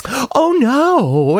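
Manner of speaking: mock dismayed woman. Imitating the woman before anything happens. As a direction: wail it like an overinvested viewer. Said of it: "she's like".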